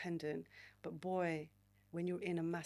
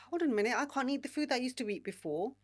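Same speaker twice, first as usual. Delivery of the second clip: raised, higher, louder protest; exasperated outburst; 5 semitones higher, 6 dB louder, faster than usual. Retold or imitated. To herself about her diet, loud exasperated. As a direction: blurt it out like it just hit you. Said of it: "And I'm like".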